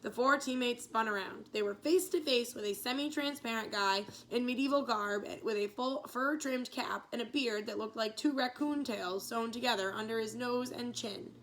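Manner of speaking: usual speaking voice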